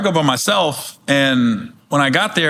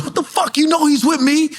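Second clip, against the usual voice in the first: Face scolding; 10 semitones higher than usual. Retold or imitated.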